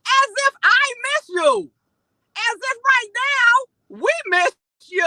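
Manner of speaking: incensed, building to outrage; indignant rant. Real, not acted. Ranting at a guest.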